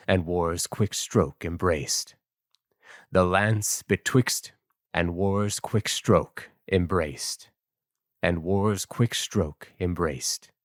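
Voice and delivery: epic verse narration. A narrator's reading. Narrator recording Chapman's verse translation, formal epic reading. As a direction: declaim old heroic poetry with crisp, full voice.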